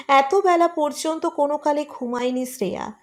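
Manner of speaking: usual speaking voice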